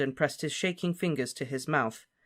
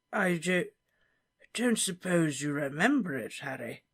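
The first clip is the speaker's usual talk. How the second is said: put-on higher, hesitant old professor; awkward question; 4 semitones higher than usual. Slughorn awkwardly asking Harry about his parents. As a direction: fumble the question uneasily. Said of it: "he asked awkwardly".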